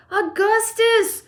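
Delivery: loud, slow, drawn-out call; crying out. A narrator's reading. Father calls his son, long loud cry. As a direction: bellow the name high and long. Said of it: "cried Mister Gloop".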